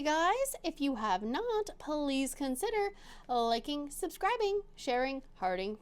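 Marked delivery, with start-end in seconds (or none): none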